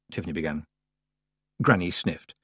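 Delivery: usual speaking voice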